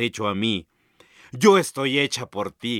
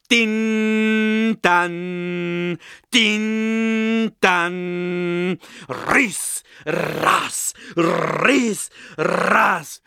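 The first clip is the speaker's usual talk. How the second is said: clang and swish imitation; 6 semitones higher, much slower than usual. Martillo y hoz suenan juntos.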